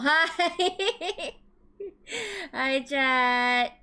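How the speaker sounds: high bubbly streamer greeting; cute anime streamer. Acted. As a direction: squeal a cute hello to chat.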